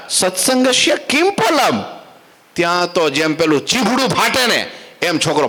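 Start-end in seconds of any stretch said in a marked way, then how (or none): 0.1-1.8 put-on higher, slow, intoned question to the baby prince; sage's question into ear. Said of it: "ધીરે રહીને ... બોલી ગયા". (ઊંચા, ધીમા સ્વરે સંસ્કૃત પ્રશ્ન)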